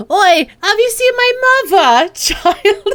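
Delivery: high, loud hammed-up Cockney; mock Cockney. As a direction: bark it like a cheeky Cockney kid, breaking into laughs. Mocking a game accent, laughing.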